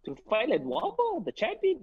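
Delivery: high, incredulous disbelief; can't believe the short notice; surprised. Retold or imitated. Retelling the Ledwaba offer to a listener, high shocked disbelief.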